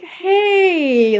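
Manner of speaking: high, drawn-out sheepish greeting; awkward hey. Retold or imitated. Caught woman greets the man who walked in, awkward and high. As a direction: stretch it up high, imitating her awkwardness. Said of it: "whole, like, awkward scratching her head thing".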